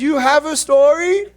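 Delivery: high, loud mock-mom question; hammed-up disbelieving mother; incredulous mom. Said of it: "She was pretty angry".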